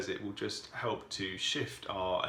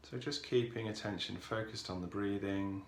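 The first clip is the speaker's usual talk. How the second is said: calm, softened voice with long pauses; guided meditation; 4 dB quieter than usual. Real, not acted. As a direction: ease listeners into stillness, unhurried and even.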